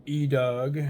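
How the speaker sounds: calling out. Retold or imitated. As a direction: shout the name upward to get his attention.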